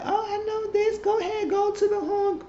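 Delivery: very high put-on voice for Charlie; high falsetto impression of a woman; mock high falsetto. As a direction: squeak it out way up high, imitating her. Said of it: "She said, Charlie said".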